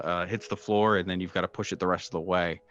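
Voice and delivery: ordinary talk, nothing marked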